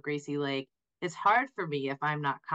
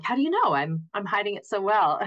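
a high, loud, hammed-up disbelief; mock surprise; 6 semitones higher, 7 dB louder than usual. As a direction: play caught out and baffled. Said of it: "And I'm like".

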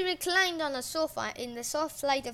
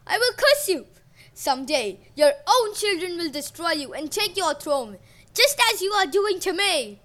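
loud, higher curse; furious curse; 4 semitones higher, 6 dB louder than usual. A narrator's reading. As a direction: lay the curse in rage. Uranus curses son.

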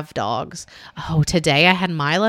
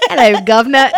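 high, loud put-on British hello; cheery hammed-up British accent; mock British greeting; 4 semitones higher, 8 dB louder than usual. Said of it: "should I do it in an accent".

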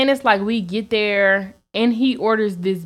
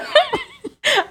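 high squealing laugh; shrieking laugh; burst of laughter. Real, not acted. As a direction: break up laughing hard. Laughing mid-story.

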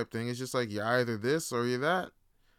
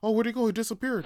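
mock onlooker surprise; 7 semitones higher, 3 dB louder, faster than usual. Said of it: "people going like".

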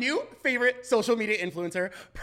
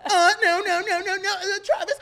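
loud, high mock protest; co-host imitation; 11 semitones higher, 11 dB louder than usual. Teasing co-host with a replay of the protest, hammed-up wail. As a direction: pitch it way up, wailing through laughter. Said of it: "You were like".

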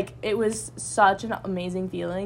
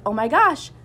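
excited quote; 5 semitones higher, 8 dB louder than usual. Retold or imitated. Friend reading acceptance list.

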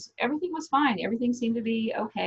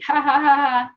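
forced laugh; 5 semitones higher, 6 dB louder than usual. Retold or imitated. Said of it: "Laughed it off".

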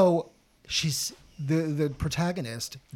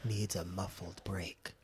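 soft, slow, wet-mouthed announcement read; wet announcer voice; 6 semitones lower, 6 dB quieter, slower than usual. Retold or imitated. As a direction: read the muffler ad in a moist, hushed radio voice. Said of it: "my N P R wet voice".

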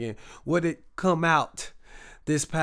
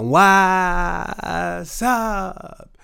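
a big, louder "What's up?" to the audience; loud greeting; 3 semitones higher, 7 dB louder than usual. Real, not acted. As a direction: call it out to the room.

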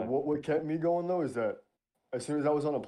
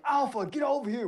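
high, barked command; drill sergeant shout; 10 semitones higher than usual. Retold or imitated. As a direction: call the platoon over sharply. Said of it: "They're like".

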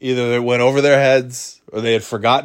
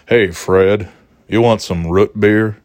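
lower, light impression of an old grandpa offering root beer; a hint of a kindly old grandpa; imitating grandpa; 4 semitones lower, 3 dB louder, slower than usual. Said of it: "that's my grandpa".

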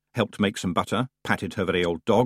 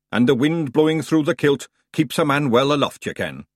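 higher, louder Scottish pixie warrior; Scottish Feegle; 5 semitones higher, 4 dB louder than usual. Acted. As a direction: boast in a broad Scottish brogue. Big Yan, Feegle.

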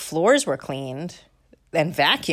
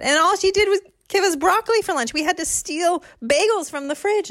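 put-on whiny kid; 5 dB louder than usual. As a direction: whine to mom about grandma. Kid complaining to mom.

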